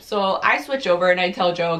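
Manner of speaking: usual speaking voice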